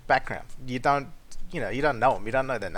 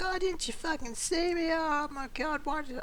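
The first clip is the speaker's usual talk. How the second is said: much higher, squeaky outraged woman voice; mock angry woman; 13 semitones higher, 5 dB quieter than usual. Mocking the driver who lost the parking spot, shrill high voice. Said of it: "So she's like".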